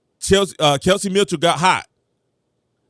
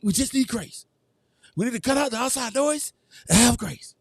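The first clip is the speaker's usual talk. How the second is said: pleading coach impression; 5 semitones higher, 5 dB quieter than usual. Coach pleading with reporters for grace, soft and high. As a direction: beg softly for grace like a meek coach. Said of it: "She's like".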